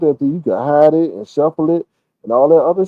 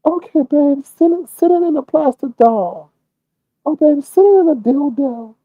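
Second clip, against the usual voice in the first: much higher cooing voice; cooing woman; 10 semitones higher than usual. Retold or imitated. Imitating a woman cooing pet names at Lemon, high and sweet. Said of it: "traffic coke for that man".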